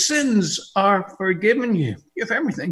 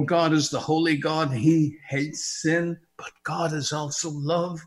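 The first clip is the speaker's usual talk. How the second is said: normal talking voice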